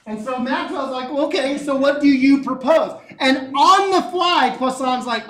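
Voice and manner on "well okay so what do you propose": loud, higher-pitched comeback; acted retort. Scientist answering his challenger in a debate, loud acted retort. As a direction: throw the challenge back, louder and higher.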